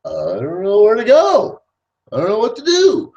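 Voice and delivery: lost recruit. Retold or imitated. Abandoned recruits in the street, mock helpless whine. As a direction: sound stranded and clueless in mock. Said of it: "leaves them standing ... going".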